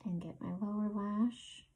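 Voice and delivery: ordinary talk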